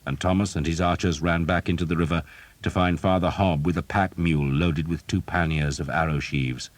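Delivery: plain reading voice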